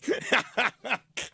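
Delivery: real, giggling breakdown; helpless laugh. Real, not acted. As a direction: crack up before the joke lands. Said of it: "I'm laughing already at my joke".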